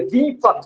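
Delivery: दीपक with a long, pushed ee; stressed long vowel. Shown as a demonstration. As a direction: lean on the first vowel of दीपक. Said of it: "जहां ज्यादा जोर लग रहा है".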